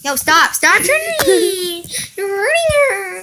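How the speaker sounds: loud laughing stop; half laughing, half yelling stop; laughing protest. Real, not acted. Stopping roughhousing with dog.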